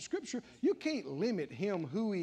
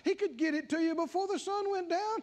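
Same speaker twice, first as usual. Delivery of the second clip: preaching at full force; 9 semitones higher than usual. Acted. Preacher exhorting his congregation about God's might, full force. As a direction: thunder God's power over the crowd.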